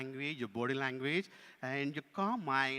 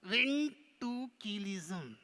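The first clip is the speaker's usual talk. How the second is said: very slow, stretched puppet attempt; struggling puppet; 6 semitones higher, 3 dB louder, much slower than usual. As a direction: sound it out laboriously as the puppet. Puppet attempts the word slowly.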